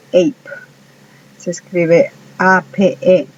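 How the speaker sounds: ordinary talk, nothing marked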